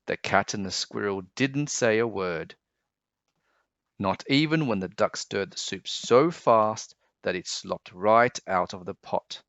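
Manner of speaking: usual speaking voice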